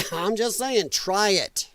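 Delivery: ordinary talk, nothing marked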